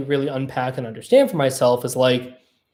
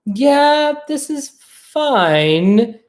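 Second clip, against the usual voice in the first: much higher, slow, grudging voice; a slow, high, grudging yeah; mock lukewarm approval; 10 semitones higher, much slower than usual. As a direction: play a crowd that shrugs it off. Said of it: "And they're like".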